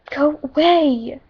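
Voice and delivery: slow, drawn-out mock yell. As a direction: shoo her away, stretching the words. Yelling at Tess.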